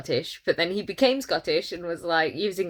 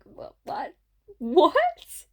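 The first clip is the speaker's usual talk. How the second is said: mock shock building; 10 semitones higher, 3 dB louder, much slower than usual. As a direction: build the disbelief up to a squeak. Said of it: "And she's like".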